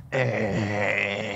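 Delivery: long, drawn-out unimpressed "eh"; dismissive noise. Retold or imitated. Champion imitating the boss's reaction, long dismissive groan. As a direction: stretch out a grudging noise.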